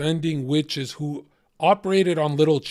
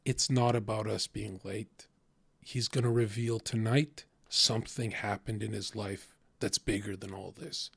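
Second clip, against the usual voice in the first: calm brother; 4 semitones lower, 10 dB quieter than usual. Retold or imitated. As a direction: lower and much softer, patient. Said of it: "my brother would be like".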